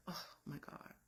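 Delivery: whispered oh my God; barely a breath, stunned; overwhelmed bride whispering in awe; breathless whisper. Retold or imitated. Bride overwhelmed by husband's speech.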